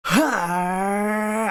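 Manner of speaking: long, high drawn-out shout; hammed-up Australian power shout; attempted Australian battle cry. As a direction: hold out a high yell, aiming for Australian. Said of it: "trying to be as Australian as possible".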